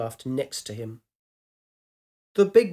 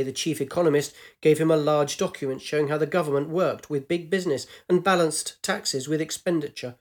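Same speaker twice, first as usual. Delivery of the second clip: ordinary delivery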